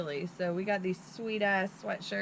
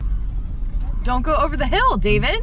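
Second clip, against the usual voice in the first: loud, high mock shout of warning; 6 semitones higher, 13 dB louder than usual. Friend reenacting the warning to David, loud mock shout. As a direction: yell it like she's stopping the driver.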